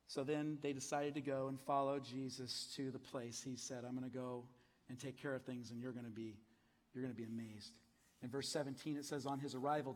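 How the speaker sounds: low, soft reading of the passage; scripture reading. Acted. Pastor reading scripture to church, low and hushed. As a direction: read it to the congregation, hushed.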